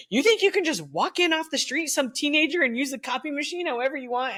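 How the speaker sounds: secretary dressing down a young teacher, hammed-up scorn; mock scolding. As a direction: scold her like a nosy teenager off the street. Secretary scolds supposed teenager.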